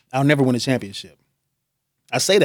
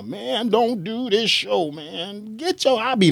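high, slow mock old-man voice; eighty-year-old self; 7 semitones higher, 4 dB quieter, slower than usual. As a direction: play your elderly self, pitched up and drawn out. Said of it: "eighty-year-old me came back to talk to me".

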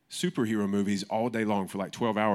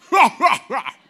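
a big, high, booming belly laugh to show what a guffaw is; 14 dB louder than usual. Shown as a demonstration. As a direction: let out one huge guffaw. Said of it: "That's a guffaw".

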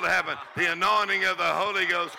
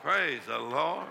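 ordinary delivery

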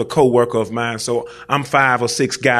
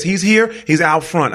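high, louder mock panic; imitating an insistent, panicked caller; alarmed; 6 semitones higher, 4 dB louder than usual. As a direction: retell an urgent warning, pitched right up. Wife repeats alarmed warning.

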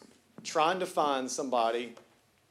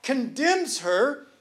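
full-force preaching; 5 semitones higher, 7 dB louder than usual. Acted. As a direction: preach it, building to the crowd's amens.

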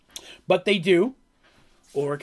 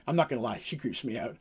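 nervous wizard; 5 semitones lower, 7 dB quieter than usual. Retold or imitated. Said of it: "The car just goes".

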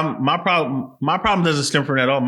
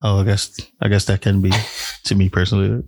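low, sultry seductive voice; sexy voice; 6 semitones lower than usual. Acted. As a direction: drop into a low, seductive murmur.

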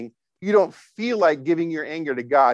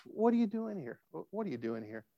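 very soft, gentle question; hushed, loving concern; tender God; 3 semitones higher, 12 dB quieter than usual. Retold or imitated. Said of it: "there's a tenderness in God's voice".

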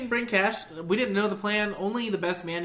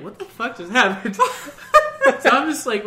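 louder, laughing disbelief; 5 semitones higher, 8 dB louder than usual. Real, not acted. Teller recalling his shock, laughing loudly. Laughing through retold reaction.

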